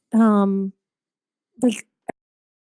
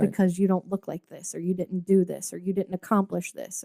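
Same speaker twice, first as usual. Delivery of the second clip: ugly inner voice; 3 semitones lower, 7 dB quieter than usual. Retold or imitated. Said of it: "that ugly voice in the back of your head".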